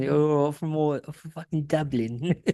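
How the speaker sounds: slow, drawn-out parody of bad Irish; mocked Irish accent. Host teasing guest's attempt, exaggerated fake Irish. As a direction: imitate a failed Irish accent. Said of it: "that's your Irish accent".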